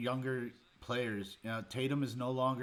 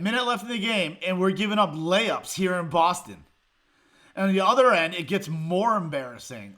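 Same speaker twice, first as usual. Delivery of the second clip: louder, higher, fuming at the collapse; worked up; 6 semitones higher, 11 dB louder than usual. Real, not acted. Fan ranting at his team's late-game collapse, fuming loud. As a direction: let the frustration boil over. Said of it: "I'm getting worked up".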